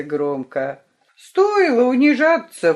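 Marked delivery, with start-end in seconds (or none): 1.2-2.7 loud, high sneering jeer; scornful snort. A narrator's reading. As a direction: scoff at them. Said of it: "фыркнул кто-то из разбойников".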